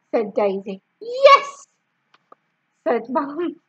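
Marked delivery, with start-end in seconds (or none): 1.0-1.7 high eager yes. A narrator's reading. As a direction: jump at it hopefully. Mum eager.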